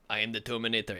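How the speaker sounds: badly faked Austrian accent; a hammy Terminator with a terrible Austrian accent; Arnold Schwarzenegger. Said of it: "worst accent I've ever heard for Arnold".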